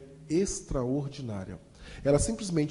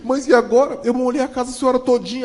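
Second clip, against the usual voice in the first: excited worry; 9 semitones higher, 8 dB louder than usual. Retold or imitated.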